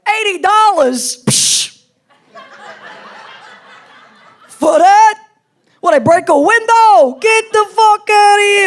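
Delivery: loud, high-pitched New York outrage; thick New York accent. Retold or imitated. Boyfriend balking at a restaurant bill, thick New York accent. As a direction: bellow it like an indignant New Yorker. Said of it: "Really thick New York accent".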